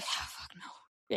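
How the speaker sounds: slow breathless whisper. Retold or imitated. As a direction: whisper it slowly, spent. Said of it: "I'm like".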